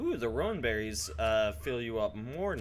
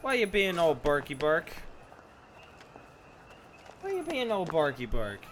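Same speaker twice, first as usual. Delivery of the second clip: pet talk; 5 semitones higher than usual. Real, not acted. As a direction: coo at the barking dogs. Talking to barking dogs.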